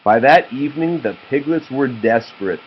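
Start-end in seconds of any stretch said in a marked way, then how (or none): none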